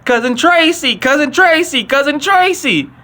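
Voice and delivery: high, drawn-out calling "Cousin Tracy"; mock phone yell. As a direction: holler down the phone for your cousin. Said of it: "on the phone yelling".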